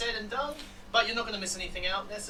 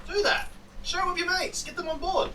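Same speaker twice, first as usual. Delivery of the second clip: excited; 11 semitones higher, 3 dB louder than usual. Real, not acted. Urging viewers to share the link.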